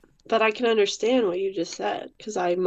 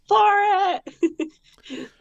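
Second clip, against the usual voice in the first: excited shout; 10 semitones higher than usual. Retold or imitated. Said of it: "I'm like".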